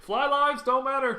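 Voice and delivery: loud, high, drawn-out shout; mock proclamation. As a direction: call it out slowly like a mock slogan. Mock slogan called out loudly.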